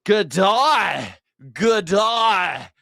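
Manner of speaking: put-on "Good day" shouted high in a laid-on-thick Aussie accent; loud cheesy Aussie "good day". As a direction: ham up the Aussie greeting for Uber riders. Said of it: "he really lays it on thick".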